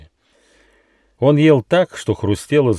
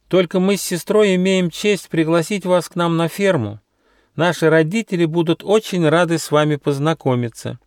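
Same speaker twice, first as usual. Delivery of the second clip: high-pitched, polite sheep voice; well-bred little sheep; 9 semitones higher than usual. Acted. Little sheep invites the travellers to the farm, courteous.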